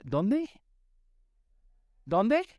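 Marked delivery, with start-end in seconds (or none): 2.0-2.5 higher, pushing the crowd to answer; preacher insisting the congregation answer; louder repeat. Shown as a demonstration. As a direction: call it out again. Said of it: "prompting congregation to answer".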